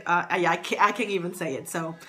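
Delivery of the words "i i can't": ordinary talk, nothing marked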